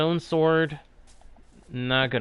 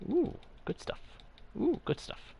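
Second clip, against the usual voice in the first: high airy whisper; whispered ooh; 11 semitones higher, 13 dB quieter than usual. Acted. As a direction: breathe it out high and hushed. Picking up items, repeated.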